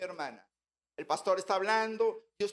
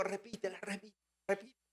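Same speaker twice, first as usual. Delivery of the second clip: soft urgent prompting, much softer; hushed prompting; 9 dB quieter than usual. Retold or imitated. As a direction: nudge her under your breath. Prompting sister quietly.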